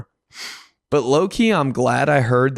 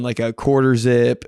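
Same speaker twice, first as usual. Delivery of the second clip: ordinary talk, nothing marked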